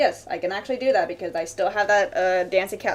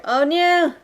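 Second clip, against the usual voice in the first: mock alarm; 8 semitones higher, 4 dB louder than usual. Real, not acted. Reacts to warning about crying.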